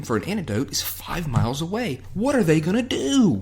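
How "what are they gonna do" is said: high, hammed-up alarm; mock dramatic. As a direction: wail the question with fake suspense. Mock suspense over the rescue.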